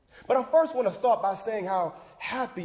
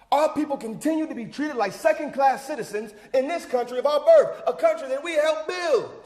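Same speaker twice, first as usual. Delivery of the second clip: raised, forceful speech-making; impassioned protest oratory; 3 semitones higher than usual. Acted. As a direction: drive the injustice home to the crowd. Orator protests second-class citizenship.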